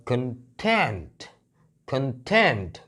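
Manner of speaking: Content as kun-TENT, slow with TENT punched; short weak kun, long falling TENT in content; stress on second syllable. Shown as a demonstration. Teacher showing the other reading, content stressed on TENT. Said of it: "अगर मैंने यहाँ stress दिया तो पढ़ा जाएगा".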